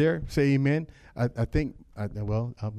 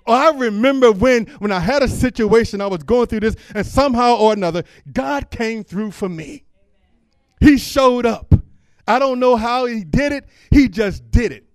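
high, loud testimony voice; preacher acting out a believer remembering God's help, loud and high; preached testimony; 7 semitones higher, 9 dB louder than usual. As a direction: testify with full force.

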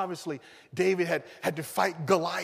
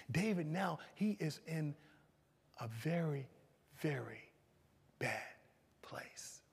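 grave dramatic pauses; 3 semitones lower, 12 dB quieter, slower than usual. Real, not acted. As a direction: drop lower and softer, word by word. Dramatic pauses on David's plight.

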